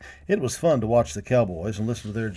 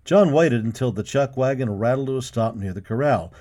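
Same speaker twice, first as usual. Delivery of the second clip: usual speaking voice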